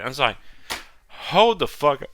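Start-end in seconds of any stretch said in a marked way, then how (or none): 1.1-2.1 stunned exclamation. Retold or imitated. Retold reaction to twist.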